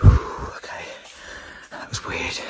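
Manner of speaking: sigh, then whisper it, rattled; hushed and unsettled, barely voiced; shaken whisper. Real, not acted. After a strange noise, whispering to himself, shaken.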